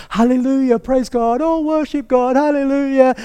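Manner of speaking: high, loud hammed-up hallelujahs; mock over-the-top worshipper. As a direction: parody a showy worshipper rushing in. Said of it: "go run into God and go".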